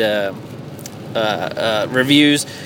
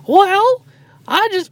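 very high squeaky cartoon voice; Mickey Mouse voice; 3 dB louder, slower than usual. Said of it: "why the fuck do I sound like Mickey Mouse".

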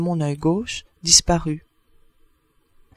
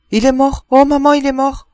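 high, loud, terrified cry; a child fleeing in sudden terror; panicked scream; 6 semitones higher, 6 dB louder than usual. A narrator's reading. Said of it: "elle parut prise d'une peur folle". (cri de terreur d'enfant)

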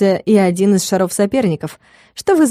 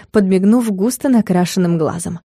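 usual speaking voice

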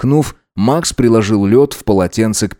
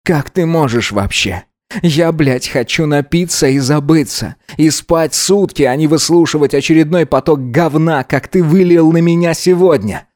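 higher-pitched, indignant outburst; raised voice; 5 semitones higher than usual. A narrator's reading. Gideon berates drunken Alex, indignation rising. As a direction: let the indignation build and climb in pitch. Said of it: "Гидеон впервые повысил голос".

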